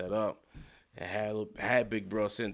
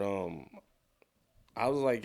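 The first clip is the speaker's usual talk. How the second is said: ordinary delivery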